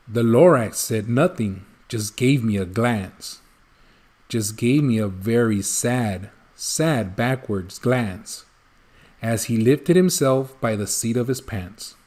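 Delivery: soft, low sorrowful narration; sad hush. A narrator's reading. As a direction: let it sink, quiet and sad. Sad silent glance, story's ending.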